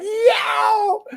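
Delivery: high laughing shout of greeting; excited yell. Retold or imitated. Host retelling spotting Waddy in the pub, laughing shout. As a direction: yell it like you just spotted a friend. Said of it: "And we were like".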